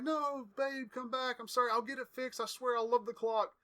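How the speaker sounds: high, pleading and panicky; lightly voiced Miz begging his girlfriend; mock pleading Miz. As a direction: beg her to come back, hammed up. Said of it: "And he's just like".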